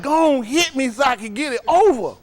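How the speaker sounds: high-pitched comic begging; mock frantic pleading. Kid walks over to parent begging for the hit, mock frantic. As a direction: plead in an exaggerated high wail.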